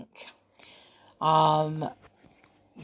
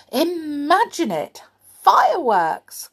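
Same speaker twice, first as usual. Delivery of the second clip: excited; 9 semitones higher, 4 dB louder than usual. A narrator's reading. Excited child imagining fireworks.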